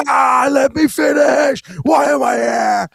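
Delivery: high, loud exasperated yell, slowed; mock Cenk outburst. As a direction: ham up a guest losing it on air. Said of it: "Cenk will be".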